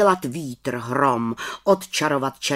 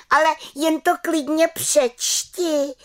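very high, slow cry; exclaiming little witch; 3 dB louder than usual. A narrator's reading. Little witch dares aunt to read the notes, exclaiming. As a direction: cry out a drawn-out dare in a high voice. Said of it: "vykřikla malá čarodějnice".

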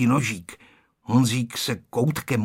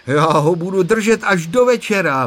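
declaring; 6 semitones higher, 6 dB louder than usual. A narrator's reading. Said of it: "prohlásil Honzík".

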